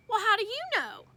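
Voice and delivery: put-on a kid poking holes in dad's answer; childlike doubt; Fudge questioning. Fudge challenging dad.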